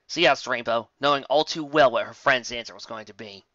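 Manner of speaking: ordinary delivery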